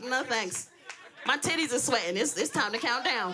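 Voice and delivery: ordinary delivery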